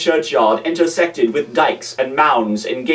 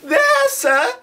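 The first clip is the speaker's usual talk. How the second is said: put-on high, timid child's answer; frightened boy. A narrator's reading. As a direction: squeak it out in fear.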